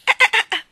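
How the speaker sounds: short very high loud dolphin squeak; one sharp squeaky dolphin call; loud high dolphin yelp. Acted. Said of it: "rispose Ranocchio".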